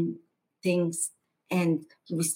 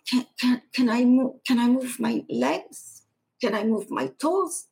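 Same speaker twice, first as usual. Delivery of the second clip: higher, louder frantic questions; panicked pleading; 6 semitones higher, 4 dB louder than usual. Retold or imitated. Panicked questions about paralysis.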